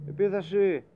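high, loud shout of attack; battle cry. Acted. Captain sending the crew into attack, shouting. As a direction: yell the charge order.

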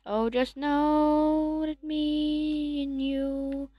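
high, very slow, tender; sweet talking. Acted. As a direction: coo it to a pet. Sweet talk to the pet.

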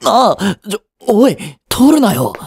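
high, panicked cries; panicked yelp. Acted. As his friend snaps a photo, high panicked yelling. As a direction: yelp in alarm as the phone comes up.

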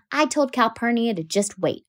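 ordinary delivery

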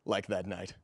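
low, soft seducer's voice; suave flirting. Acted. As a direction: linger on each phrase, low and flirtatious. Old flame recalling their night.

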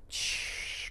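soft unvoiced shush. Real, not acted. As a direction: hush them gently. Coach hushing class.

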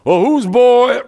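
loud, slow, booming preacher voice; great big booming voice. Retold or imitated. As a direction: boom it out like a big preacher from the pulpit. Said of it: "in his great big booming voice".

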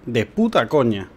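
usual speaking voice

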